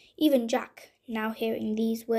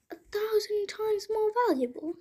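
thinking aloud; 9 semitones higher than usual. Acted. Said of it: "he thought to himself".

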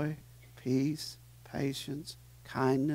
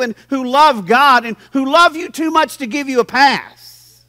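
full-force preaching; 7 semitones higher, 9 dB louder than usual. Acted. Pastor urging his church to accept correction, loud preaching. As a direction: preach it hard to the congregation.

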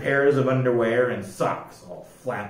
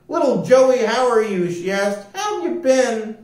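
affectionate greeting; 8 semitones higher than usual. A narrator's reading. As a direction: greet him lovingly.

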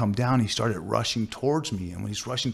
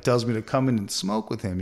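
ordinary delivery

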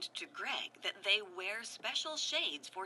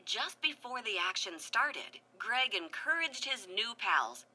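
ordinary delivery, nothing marked

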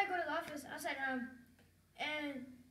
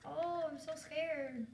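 slow sarcastic pretend fear; drawn-out, unimpressed mock scare; much slower than usual. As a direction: drag it out, feigning fright. Mocks mother's threat of spanking.

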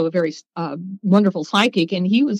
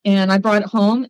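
ordinary talk, nothing marked

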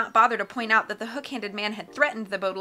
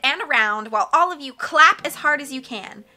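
loud piercing brat; 3 semitones higher, 8 dB louder than usual. Acted. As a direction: announce it like a bratty star. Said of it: "loud, piercing voice of Carmelita".

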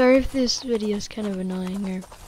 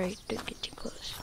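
whispering; 15 dB quieter than usual. Real, not acted. Quiet aside while fighting.

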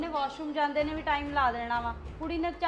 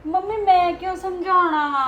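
higher, louder, slow protest; mother protesting to grandmother, higher and loud; shocked protest; 6 semitones higher, 10 dB louder than usual. Acted. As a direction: deny it loudly.

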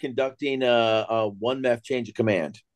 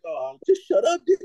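higher, breaking into laughter; laughing plea. Retold or imitated. Narrator silently begging sergeant major to stop, laughing exasperation. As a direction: beg him to stop through laughter. Storyteller's acted reaction, laughing.

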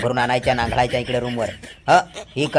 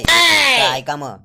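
high, loud and very slow; pompous listen-up; grand announcement; 9 semitones higher, 5 dB louder, much slower than usual. Acted. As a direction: drag it out like a proclamation. Landlord announcing rules grandly.

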